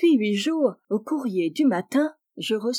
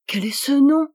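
higher, louder sudden alarm; alarmed surprise; 6 semitones higher, 6 dB louder than usual. Acted. Wife finding a stranger's name on the letter, alarmed. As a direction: blurt it out in shock.

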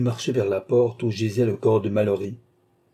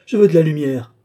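high, loud panicked scream; a woman shrieking in panic; 5 semitones higher, 7 dB louder than usual. A narrator's reading. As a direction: cry out to stop it, terrified. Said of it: "hurla une voix féminine".

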